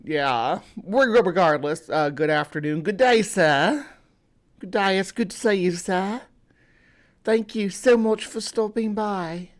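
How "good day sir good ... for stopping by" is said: hammed-up British accent, pitched higher; a bad stagey British gent greeting the chat; mock British accent, deliberately bad; terrible British accent. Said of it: "A terrible British accent".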